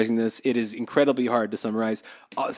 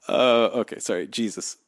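usual speaking voice

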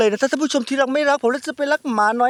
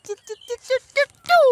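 high-pitched chirps like a myna; myna bird call; 3 dB louder than usual. Acted. Vlogger showing viewers the countryside sounds, imitating a myna's chirping. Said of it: "เสียงนกเอี้ยงร้อง".

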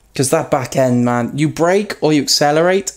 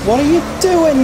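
indignant high outcry. Real, not acted. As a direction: shout at the driver who hit you. Another car hits him.

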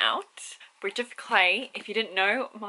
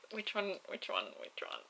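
fading to a hush; 13 dB quieter than usual. Real, not acted. Repeating question, fading out.

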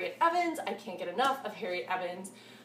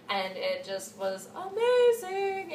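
drawn-out, slow and higher; gushing; 8 semitones higher, 5 dB louder, much slower than usual. Real, not acted. Reader gushing to viewers about a book that was amazing. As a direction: stretch out amazing with delight.

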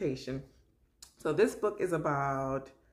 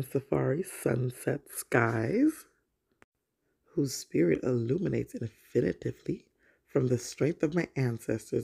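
put-on reading to children; 3 semitones lower, 6 dB louder than usual. Mom reading a picture book to young viewers, storybook voice. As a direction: read it out like story time for little ones.

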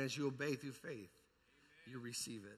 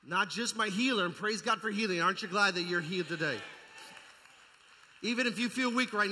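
loud, higher, driving sermon; full-force preaching; 4 semitones higher, 9 dB louder than usual. Real, not acted. Preacher rousing the church, loud and driving.

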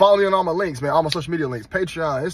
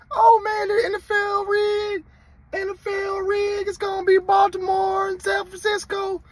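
high, slow whiny mock crying voice; mocking whiny fans. Mocking online fans in a high whiny wail. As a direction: whine like a sore fan, pitched way up. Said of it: "I seen y'all posting online".

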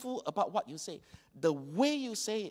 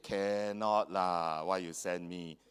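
reluctant protest; 11 semitones lower, slower than usual. Retold or imitated. Retold protest to pastor.

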